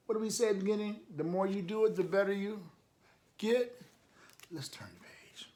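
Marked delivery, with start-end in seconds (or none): 4.5-5.5 soft unvoiced whisper to turn the page; hushed aside to the listener. Real, not acted. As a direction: whisper it under your breath. Aside while reading aloud.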